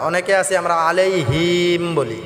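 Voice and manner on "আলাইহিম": "আলাইহিম" with a long drawn hiim; wrongly stretched him. Shown as a demonstration. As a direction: drag out the last syllable of "আলাইহিম". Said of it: "এটা টান করা যাবে না".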